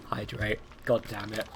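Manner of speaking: ordinary delivery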